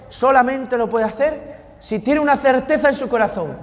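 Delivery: rising passionate pulpit delivery; fervent preaching. Acted. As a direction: preach it out to the congregation with fire. Preaching at full force.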